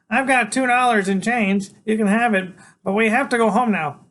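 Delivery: put-on high, loud kid's voice; pleading Freak. A narrator's reading. Freak offers Iggy his change to leave, high pleading. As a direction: bargain in a high little voice.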